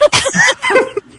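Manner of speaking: a shrill burst of laughter; high squealing laugh. Real, not acted. Cracking up while reading the story aloud.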